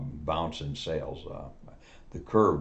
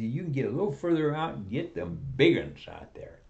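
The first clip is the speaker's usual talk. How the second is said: eager salesman; 8 semitones higher than usual. Acted. Salesman pitching a boat.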